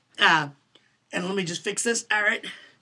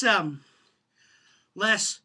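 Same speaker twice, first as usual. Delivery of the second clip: ordinary delivery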